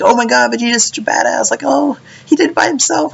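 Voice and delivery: high, squeaky fanboy voice; mocking gushing fans. Mocking fans praising Vegeta, high gushing voice. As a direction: gush in a silly high voice.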